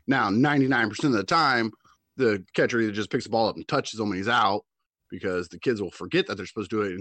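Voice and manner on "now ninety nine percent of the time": ordinary delivery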